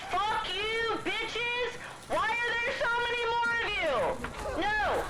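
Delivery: high, strained yell at enemies; angry yelling. Real, not acted. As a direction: scream it at the swarm.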